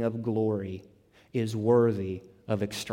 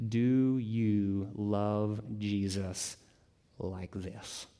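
slow searching question; much slower than usual. Shown as a demonstration. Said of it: "my question for you is this".

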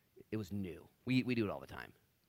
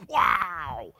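loud, higher whoop of delight; excited shout; 5 semitones higher, 13 dB louder than usual. Retold or imitated. Disciples elbowing each other, excited whooping shout.

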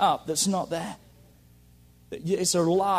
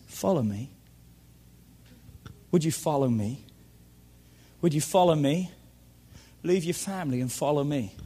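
softer, gentle invitation of Jesus; a hint of Jesus calling; 6 semitones lower, 4 dB quieter than usual. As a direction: invite them quietly to follow. Preacher voices Jesus calling people.

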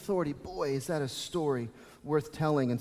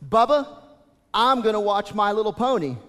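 louder, lightly voiced child's announcement; voiced little girl; 4 semitones higher, 7 dB louder than usual. Dad imitating his daughter telling her brother her turn, lightly voiced. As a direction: imitate a small girl asserting herself. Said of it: "And I heard her say".

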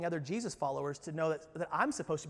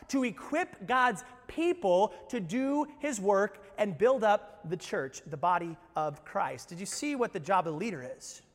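ordinary talk, nothing marked

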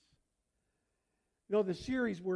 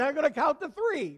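laughing parent threat; 7 semitones higher, 8 dB louder than usual. Retold or imitated. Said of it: "You say".

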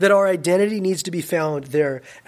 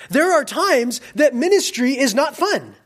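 ordinary delivery

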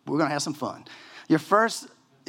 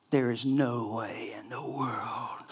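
whispered oath; 3 semitones lower, 5 dB quieter, slower than usual. Retold or imitated. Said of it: "And Peter was like".

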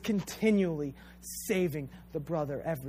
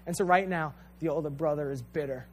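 ordinary delivery

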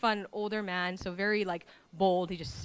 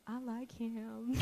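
soft gushing; 2 semitones higher, 9 dB quieter, slower than usual. Retold or imitated. Surprised crush confession.

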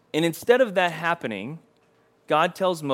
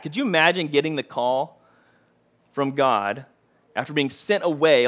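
ordinary talk

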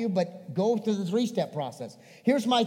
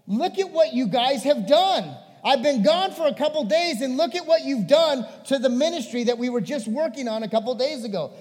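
exasperated scolding, high and loud; raised-voice frustration with followers; frustrated Jesus; 6 semitones higher, 5 dB louder than usual. Acted. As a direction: lay into them like a let-down master. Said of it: "he's frustrated with his disciples".

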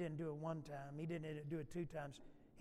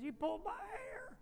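high-pitched mock wail; whiny child voice climbing high; crying little girl; 12 semitones higher than usual. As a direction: imitate a tearful little girl's complaint. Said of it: "she's crying".

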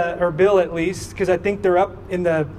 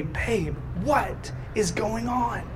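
much softer, slow and gentle; consoling; 2 semitones higher, 9 dB quieter, slower than usual. Retold or imitated. Parents finally console crying daughter, soft gentle asking. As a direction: soothe an upset child quietly. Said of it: "We finally console her".

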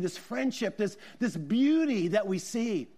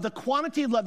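ordinary talk, nothing marked

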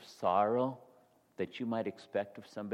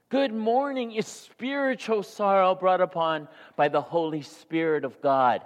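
higher and louder, driving sermon climax; full-force preaching; 8 semitones higher, 10 dB louder than usual. Acted. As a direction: preach it out to the back row.

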